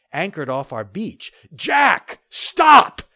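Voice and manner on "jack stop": loud high shout. Acted. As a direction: shout it across the water. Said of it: "he called behind me".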